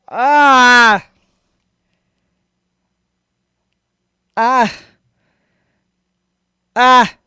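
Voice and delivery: loud, high cries with gaps between; horrified cries. Real, not acted. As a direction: keep crying out in revulsion. Recoiling from a gory clip.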